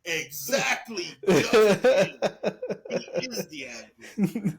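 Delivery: slow, laughing punchline. Real, not acted.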